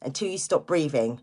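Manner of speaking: ordinary delivery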